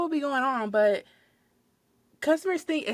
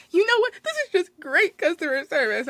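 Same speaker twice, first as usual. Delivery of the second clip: mock crying; 8 semitones higher than usual. Crying customer scolding store worker, mock crying. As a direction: imitate a crying customer's indignant outburst. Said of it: "she's, like, crying at this point".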